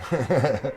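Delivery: short quick chuckle; amused chuckle. Real, not acted. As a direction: chuckle as your hunch comes true. Laughs as prediction comes true.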